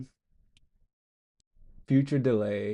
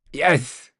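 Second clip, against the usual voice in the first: ordinary talk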